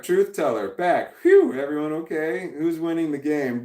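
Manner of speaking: ordinary talk, nothing marked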